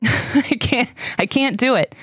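overwhelmed and giving up; real overwhelmed. Real, not acted. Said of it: "I can't handle it".

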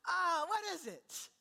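slow, high-pitched mock dismay; ungrateful puzzlement. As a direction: drag it out as a hammed-up grumble. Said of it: "And yet the people of God".

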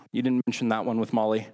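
usual speaking voice

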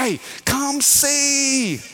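high, long drawn-out Louisiana call; hammed-up Louisiana calling, very slow; Louisiana holler. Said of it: "We say that in Louisiana".

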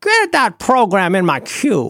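high, drawn-out bad film-star impersonation; mock a gangster-movie star, stretching the words; Edward G. Robinson. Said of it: "talking like a bad impersonation of Edward G".

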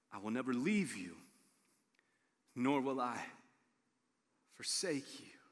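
calm the worried believer's fear; gentle answer. Acted. God answers the worry.

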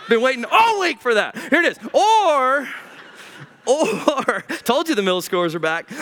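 ordinary delivery

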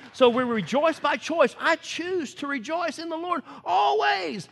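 rising exhortation; preaching build. Real, not acted. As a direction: drive the line home to the congregation. Preaching climax on rejoicing.

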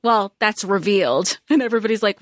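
ordinary talk, nothing marked

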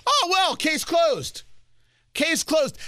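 high, mock-relieved "case closed"; sarcastic mock relief. Real, not acted. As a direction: scoff at it with fake relief. Sarcastic dismissal of apology.

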